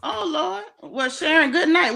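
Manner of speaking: high, loud bright goodnight; excited call-out. Real, not acted. Calling out goodnight to viewer.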